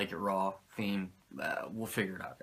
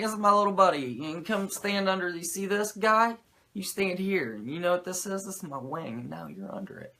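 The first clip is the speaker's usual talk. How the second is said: lightly voiced proud big brother, higher; Razor boasting; 7 semitones higher than usual. Razor presents his little buddy, lightly mock-proud. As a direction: show off the little buddy, puffed up. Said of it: "Razor's like".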